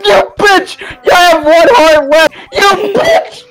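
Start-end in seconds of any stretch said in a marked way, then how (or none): none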